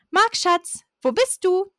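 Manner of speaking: put-on high, syrupy call for her boyfriend; sugary girlfriend call. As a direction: coo his name like the jealous girlfriend. Rival girl calls for Marc.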